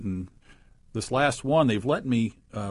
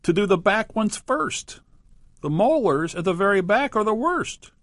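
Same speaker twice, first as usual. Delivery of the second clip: higher, louder crocodile voice, rising on first; a greedy crocodile making demands, pitched up; crocodile declaring; 10 semitones higher, 5 dB louder than usual. A narrator's reading. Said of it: "Crocodile declared".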